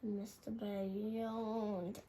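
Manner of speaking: ordinary delivery, nothing marked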